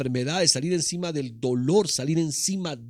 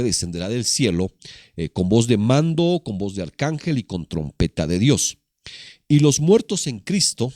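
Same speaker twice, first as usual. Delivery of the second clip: reading the verse out loud, with weight; booming preacher reading scripture; 3 semitones lower, 6 dB louder than usual. Acted. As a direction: proclaim the verse to the church.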